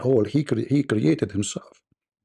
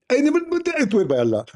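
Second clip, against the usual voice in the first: very high squeaky mock denial; mocking Zakir Naik; faster than usual. Parodying the rival preacher's excuse in a squeak. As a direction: squeal it like a toothless lecturer. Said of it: "You will speak like Zakir Naik".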